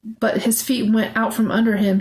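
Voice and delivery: ordinary delivery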